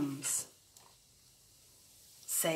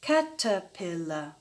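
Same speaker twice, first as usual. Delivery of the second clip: "Caterpillar" split evenly into four beats; measured syllable-by-syllable "Caterpillar"; four separate beats. Shown as a demonstration. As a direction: tap out "Caterpillar" as Ca-ter-pil-lar. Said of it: "four beats like this".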